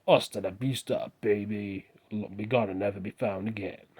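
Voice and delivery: drawn-out action-film catchphrase voice; put-on movie cyborg voice; Terminator. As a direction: do a slow robot-assassin impression. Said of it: "you can be the Terminator".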